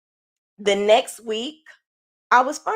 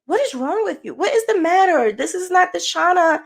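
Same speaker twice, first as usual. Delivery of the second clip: imitating a worried therapist, pitched higher; a light impression of the therapist, pitched higher and pressing; 8 semitones higher than usual. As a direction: play the concerned therapist, voice raised up high. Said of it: "she just kept saying".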